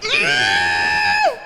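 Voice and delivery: sharp gasp. Real, not acted. Reacting to a character reveal.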